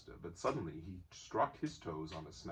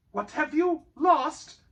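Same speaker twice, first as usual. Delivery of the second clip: higher, louder, slow insistence; Bilbo persisting; 15 dB louder, slower than usual. A narrator's reading. Bilbo persisting against approaching Gollum. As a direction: keep pressing stubbornly. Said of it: "Bilbo persisted".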